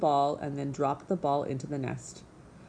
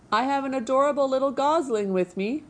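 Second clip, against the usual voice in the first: higher, cheerful announcement; friendly booming; 8 semitones higher, 4 dB louder than usual. A narrator's reading. Robot calling to a goose across the water, friendly booming voice. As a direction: call it out with eager friendliness. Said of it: "the robot's friendly voice boomed".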